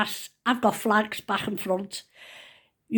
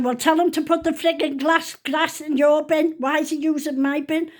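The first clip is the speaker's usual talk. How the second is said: higher-pitched scolding; mock telling-off; retold indignant complaint; 7 semitones higher, 4 dB louder than usual. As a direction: imitate a cross neighbour complaining. Retold neighbour's quarrel over bins.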